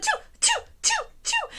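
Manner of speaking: a run of very high imitated sneezes; kitten sneezing. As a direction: squeak out quick little kitten sneezes. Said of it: "so she was like".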